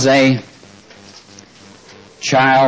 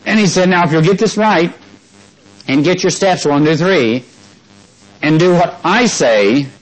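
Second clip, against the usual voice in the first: fervent preaching; 6 semitones higher than usual. Acted. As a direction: preach it with full conviction. Preaching with rising fervor.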